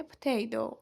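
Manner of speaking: Potato as p'tay-doh, first vowel swallowed; teacher repeating American-style Potato; native-like potato. Shown as a demonstration. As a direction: flap the final T in Potato. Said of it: "بگید potato".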